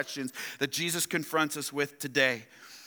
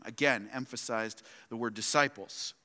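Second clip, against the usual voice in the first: ordinary delivery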